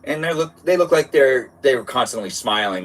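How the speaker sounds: ordinary delivery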